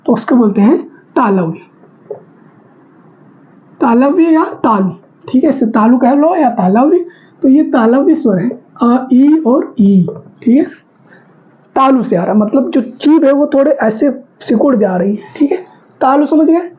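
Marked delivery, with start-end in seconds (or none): none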